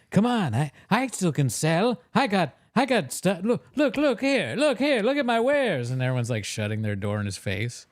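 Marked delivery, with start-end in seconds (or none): 0.1-5.9 high-pitched, pleading salesman nobody buys from; pathetic salesman. Acted. Salesman pushing wares as doors shut, pathetic pleading. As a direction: beg like a washed-up peddler, pitch climbing. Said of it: "pathetic. Just like".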